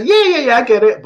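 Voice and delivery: high, loud, impatient mock agreement; glib agreement. Listener claiming to understand intellectually, glib mock agreement. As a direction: brush it off as if already understood.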